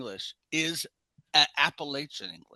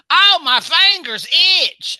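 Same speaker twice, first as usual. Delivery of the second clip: loud, high, slow hammy line; overwrought actor; 14 semitones higher, 14 dB louder, slower than usual. Retold or imitated. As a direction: overact it terribly. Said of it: "this guy in my class went".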